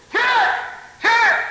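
loud high cry of here; calling out. Acted. Wounded soldier calling loudly to the nurse. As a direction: call out across the field, loud and high.

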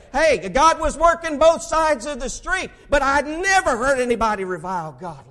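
rally the congregation; preaching. Acted. Preacher celebrating the church's harvest to his people, fiery.

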